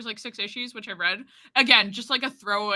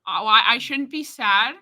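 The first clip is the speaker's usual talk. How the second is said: ordinary talk, nothing marked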